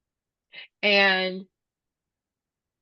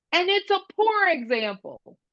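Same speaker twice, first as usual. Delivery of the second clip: mock scream; 10 semitones higher than usual. Child throws the example back at classmate, mild mock scream. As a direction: imitate a child's scream without real volume. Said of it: "B J screams and he says".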